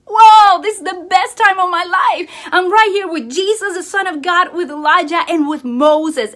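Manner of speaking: loud, high, thrilled Peter; excited Peter. Retold or imitated. Peter overjoyed on the mountain, loud and excited. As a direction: burst out like a thrilled disciple. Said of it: "and he's like".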